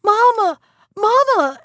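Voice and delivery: put-on girl screaming for her mother as the wolf lunges; crying out. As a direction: call for mother in terror.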